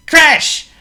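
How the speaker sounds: loud high crash; crash sound. Acted. Miss Noisy drumming at breakfast, loud crash noise. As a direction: make it smash like a cymbal.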